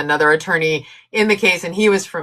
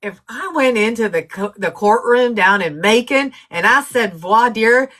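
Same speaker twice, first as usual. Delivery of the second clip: put-on Southern accent; 4 semitones higher than usual. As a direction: ham up a thick Southern country lawyer. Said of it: "a real thick Southern accent".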